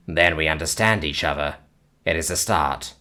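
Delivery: dry understated agreement; calm alien. Acted. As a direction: alien settling the matter. Alien concludes.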